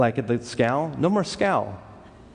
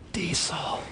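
hushed, unvoiced whisper of realization; whispered "Diesel" as the mistake sinks in; whispered dread; 3 semitones higher, 7 dB quieter than usual. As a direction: breathe the word out in horror. Realizing his fuel mistake.

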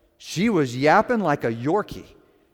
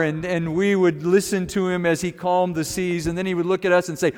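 ordinary talk

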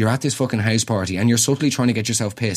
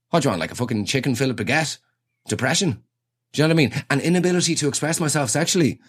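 usual speaking voice

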